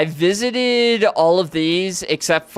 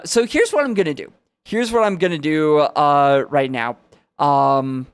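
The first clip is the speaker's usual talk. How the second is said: ordinary talk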